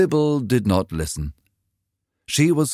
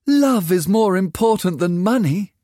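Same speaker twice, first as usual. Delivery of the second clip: put-on a raised, eager girl's voice; a dreamy girl defending love over money; young girl Sybil; 13 semitones higher, 4 dB louder than usual. A narrator's reading. Daughter arguing with her mother, eager and raised.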